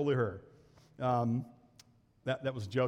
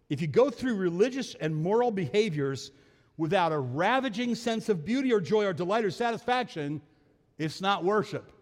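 preaching the quote; 6 semitones higher, 6 dB louder than usual. Retold or imitated. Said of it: "Tim Keller said this".

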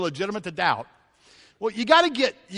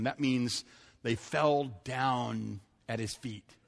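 ordinary talk, nothing marked

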